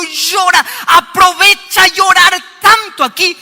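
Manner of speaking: loud, high urging cry to weep; urging shout. Retold or imitated. Pastor loudly urging a grieving man to weep. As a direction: urge him out loud to cry it all out. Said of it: "le digo".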